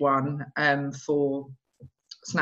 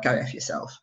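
whispered, half under the breath; muttered insult; 5 semitones lower, 7 dB quieter than usual. Retold or imitated. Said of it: "we'd be like".

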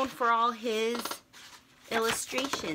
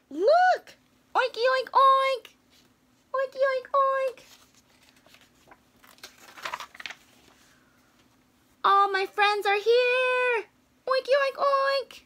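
much higher, delighted Piggie voice; excited Piggie; 13 semitones higher, 5 dB louder than usual. Acted. Piggie greets her pig friends, high and excited. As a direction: squeal it with joy, much higher.

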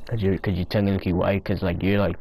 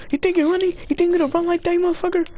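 high shouted taunt, much higher; shrill-free high mocking shout; taunting yell; faster than usual. Real, not acted. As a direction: yell after a running enemy. Yelling at fleeing enemy.

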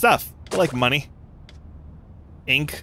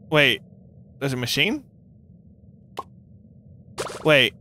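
ordinary talk, nothing marked

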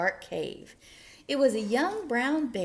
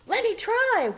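high, loud eager whoop; bursting in, high and loud; excited whoop; 11 semitones higher, 7 dB louder than usual. A narrator's reading. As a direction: whoop it out like an eager little bear. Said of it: "whooped Douglas".

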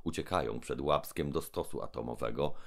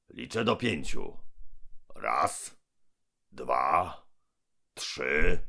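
menacing giant mouth. Acted. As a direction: boom down from above. Gębon threatens the robots.

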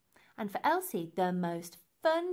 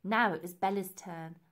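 ordinary delivery

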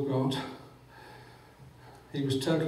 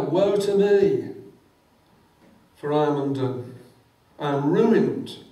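Isaiah's lament; 7 dB louder than usual. Acted. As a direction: cry out as a man undone.

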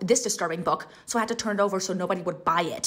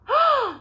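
sharp, high gasp of delight; thrilled gasp at the blurb; excited gasp; 6 dB louder than usual. Real, not acted. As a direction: gasp like the plot just hooked you.

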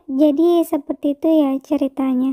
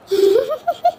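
giggle; 8 semitones higher than usual. Acted. Shop clerk charmed.

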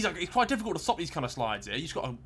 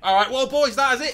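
high, loud sign-off; outro announcer; 9 semitones higher, 8 dB louder than usual. Acted. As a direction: wrap it up like a host. Signing off the video.

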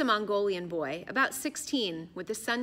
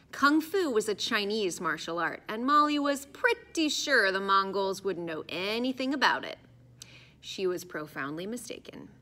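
ordinary delivery, nothing marked

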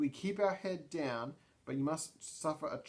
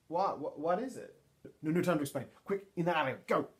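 higher, louder urgent rush, hiding from someone; urgent hurry; 4 semitones higher, 4 dB louder, faster than usual. Acted. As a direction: urgently hurry her into the alley. Bartney asks, Race urges hiding.

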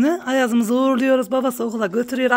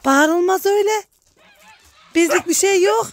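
much higher, sing-song pet-talk to the geese; talking to animals; 9 semitones higher than usual. Real, not acted. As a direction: coo and tease the birds like babies. Cooing at geese for many lines. (kazlarla ince, sevecen sesle konuşma)

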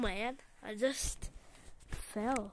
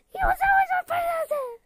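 much higher, fast whiny wail; mock wail; 9 dB louder, faster than usual. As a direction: whine it out like a heartbroken kid. Mock grief, high fast.

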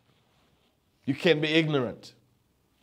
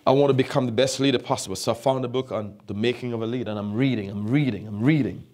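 ordinary talk, nothing marked

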